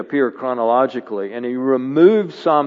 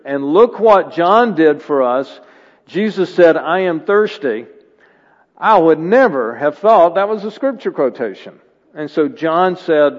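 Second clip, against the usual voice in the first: louder, higher preaching push; 4 semitones higher, 6 dB louder than usual. Acted. Pastor urging the congregation, louder preaching. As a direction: drive the point home from the pulpit.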